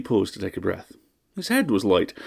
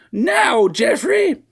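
very high, loud screech; shrill shout; 7 dB louder, much slower than usual. A narrator's reading. Mistress cutting off her butler, screeching. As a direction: cut him off with a shriek.

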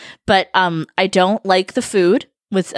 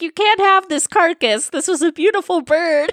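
indignant turkey rescuer; 8 semitones higher than usual. Pretend.

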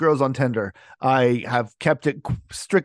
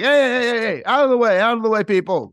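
calling out; 6 semitones higher than usual. Retold or imitated. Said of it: "and I'm like".

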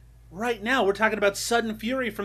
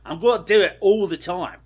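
hammed-up British accent; 4 dB louder than usual. Warning listeners about the accent to come, mock British. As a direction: put on a cheeky British voice. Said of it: "I will pull out my British accent".

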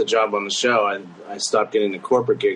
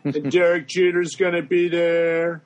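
higher, put-on Trump impression; 6 semitones higher than usual. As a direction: talk like Trump at a rally. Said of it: "the voice he uses now".

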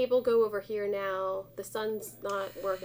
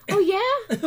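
loud, high-pitched sarcastic comeback; mock indignation; 12 semitones higher, 9 dB louder than usual. Decorator answering an unwanted plaid chair suggestion, mock indignant. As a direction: throw it back as a hammed-up challenge.

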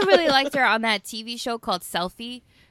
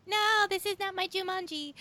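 high whiny mocking fan voice; mock a whiny fanboy; troll voice; 12 semitones higher, 9 dB quieter than usual. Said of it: "That's what trolls sound like".

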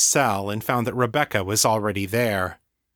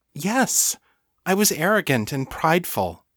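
higher-voiced, understated admission; simple, factual confession in a lighter, higher voice; matter-of-factly; 7 semitones higher than usual. A narrator's reading. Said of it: "Lena said matter-of-factly".

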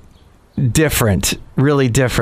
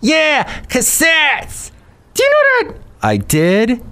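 Bison Bentley voice; 13 semitones higher than usual. Acted. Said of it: "this is Bison Bentley".

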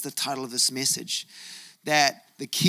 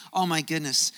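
usual speaking voice

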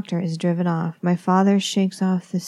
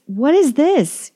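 much higher, louder, slow anguished cry; 7 semitones higher, 6 dB louder, slower than usual. A narrator's reading. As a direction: break into a desperate howl of the question. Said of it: "he cries".

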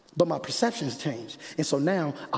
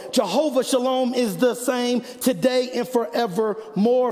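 high, driving sermon voice; raised pitch, urgent build; impassioned preaching; 7 semitones higher than usual. Real, not acted. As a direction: preach it out to the whole congregation. Preaches at full force.

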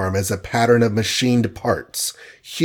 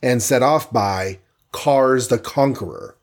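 ordinary delivery

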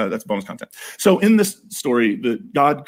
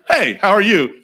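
higher, louder breezy hello from a talking snake; casual snake greeting; 6 semitones higher, 5 dB louder, slower than usual. Retold or imitated. Talking snake strolls into the garden, breezy and louder. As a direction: play the snake as chummy and nonchalant. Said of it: "just walks in and is like".

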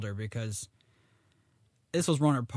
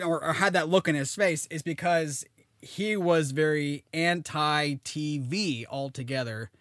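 ordinary talk

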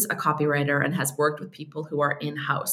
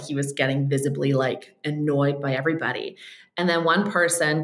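usual speaking voice

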